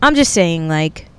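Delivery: ordinary talk, nothing marked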